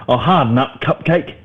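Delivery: mock mum. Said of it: "She says to me".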